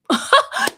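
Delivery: high burst of laughter; real high-pitched laugh. Real, not acted. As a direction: laugh at your own joke. Laughs at own joke.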